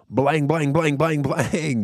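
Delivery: loud, high mouth gunfire; gunshot sounds. Retold or imitated. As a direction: blast out fake gunshots. Imitating gunshots.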